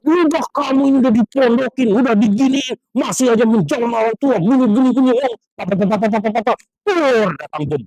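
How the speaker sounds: imitating a mother's exploding scold; mock angry mother. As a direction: rant like a fed-up mom. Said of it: "Marah dia".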